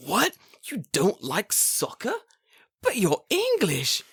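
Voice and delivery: high, slow, drawn-out shock; mock disbelief. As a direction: act scandalised, stretching every word high. Shocked reaction to soccer answer.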